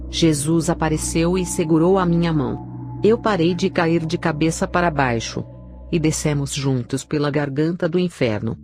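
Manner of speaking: usual speaking voice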